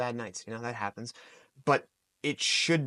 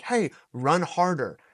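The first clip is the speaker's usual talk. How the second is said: raised, drawn-out coach's command; fight-camp coaching; 5 semitones higher, 5 dB louder, slower than usual. Acted. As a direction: push them on like a drill coach. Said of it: "especially at a fight camp, being like".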